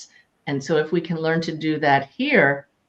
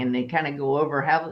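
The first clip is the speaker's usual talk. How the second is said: ordinary talk, nothing marked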